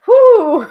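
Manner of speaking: high, loud startled whoo; pained whoo after hiccup. Real, not acted. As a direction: blow out the sting of a hiccup. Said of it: "like, painful hiccups?".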